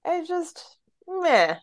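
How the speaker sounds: tired, drained voice; low energy. Real, not acted. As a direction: sound worn out and listless. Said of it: "I'm low energy right now".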